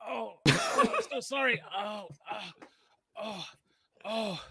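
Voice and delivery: high, whimpering mock pain, then a loud insult; hammed-up groaning. Elias on the ground faking injury to the courier, high and whiny. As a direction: fake hurt moans, then a parting jab. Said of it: "Elias on the ground is like".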